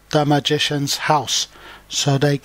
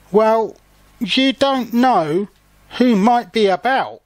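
ominous warning; 7 semitones higher than usual. A narrator's reading. Jeremy warning his uncle about the garden, mildly ominous. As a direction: raise the pitch and draw out the caution. Jeremy warns of possible danger.

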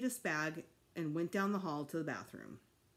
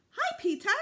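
put-on much higher, louder little-boy hello; four-year-old greeting his big brother; little kid greeting; 8 dB louder than usual. A narrator's reading.

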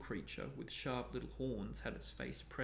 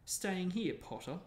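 shouted jeer; 6 semitones higher, slower than usual. A narrator's reading. Malfoy jeering at Harry from the line, shouted. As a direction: shout it across at him, raised in pitch. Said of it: "shouted Malfoy".

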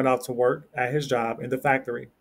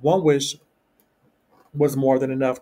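a louder protest; crying out; 4 dB louder than usual. Acted. Husband refusing his wife's plan, protesting louder. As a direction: push back, alarmed, a bit louder. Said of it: "cried the husband".

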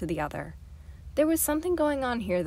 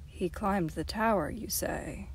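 plain reading voice

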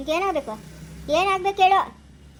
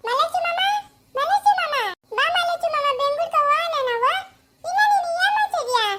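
put-on much higher small-child voice, calling eagerly; 9 semitones higher than usual. Little girl coaxing her uncle to go to Bengaluru, in a high child voice.

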